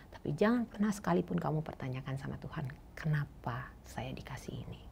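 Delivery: softly voiced mother's solemn warning; a hint of mother's grave quiet advice; hushed warning. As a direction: hush down as if passing on a sacred rule.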